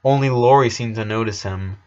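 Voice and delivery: plain reading voice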